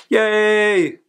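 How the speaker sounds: high, loud little cheer. Real, not acted. Cheering to viewers about her citizenship oath, excited. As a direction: celebrate it out loud.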